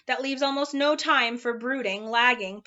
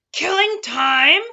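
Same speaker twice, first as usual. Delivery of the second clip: much louder, higher slow outraged roar; 6 semitones higher, 11 dB louder, slower than usual. Acted. Watchdog outraged at Milo, roaring much louder. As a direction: bellow it in disbelief. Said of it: "roared the dog".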